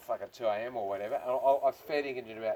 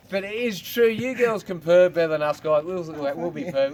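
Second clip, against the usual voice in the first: ordinary talk, nothing marked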